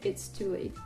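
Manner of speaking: ordinary talk, nothing marked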